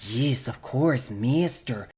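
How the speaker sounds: slow, creepy mad-scientist's assistant; drawn-out old-timey henchman accent; Igor. Acted. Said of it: "like, Igor, mad scientist, lab assistant accent".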